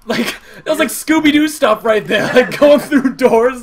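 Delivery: loud, high amazed laughter-talk; excited. Real, not acted. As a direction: gush about the wild escape. Excited about escape.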